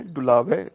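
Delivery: ਡੁਲਾਵੈ with the long ai vowel at the end, dulaavai; ਡੁਲਾਵੈ ਦਾ ਅਖੀਰ ਐ ਵਾਂਗ ਬੋਲੋ; dulavan demo. Shown as a demonstration. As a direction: end ਡੁਲਾਵੈ on a clear ai sound, not e. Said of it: "ਵਾਵੇ ਨੂੰ ਦੁਲਾਵਾਂ ਹੈਗੀਆਂ ਨੇ".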